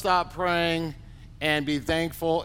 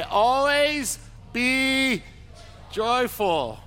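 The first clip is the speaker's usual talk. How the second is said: "Always be joyful" stretched slowly, higher and lifted; slow emphatic call; 7 semitones higher, much slower than usual. Shown as a demonstration. Pastor urging people to tell a neighbour, slow and raised. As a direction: lift "Always be" high and slow, then land "joyful". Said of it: "say it to somebody".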